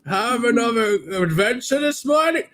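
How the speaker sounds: high, hammy fatherly greeting; pompous old tree hailing the boy. Acted. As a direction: play a jolly old guardian tree. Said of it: "And he's like".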